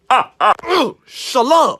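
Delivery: high, slow "Ugh, shalom"; mock disgust; disgusted farewell. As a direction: recoil from the smell. Disgust at bad breath.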